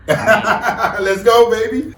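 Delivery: laughing, high and loud; laughing cheer. Real, not acted.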